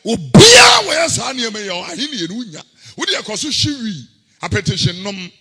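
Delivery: loud, chanted Twi sermon with shouted peaks; fiery pulpit chant; preaching at full force. Acted. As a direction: preach it hard, half singing.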